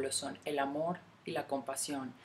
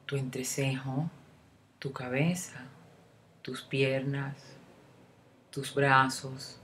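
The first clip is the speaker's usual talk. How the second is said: guided meditation; 4 dB louder than usual. Acted. Guide leading a listener through a love meditation, unhurried meditation voice. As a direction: lead the listener gently inward, phrase by phrase, leaving room to breathe.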